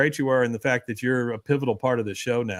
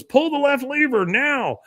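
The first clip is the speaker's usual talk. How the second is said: urgent high order; 12 semitones higher than usual. Retold or imitated. Retelling Trip's urgent instruction.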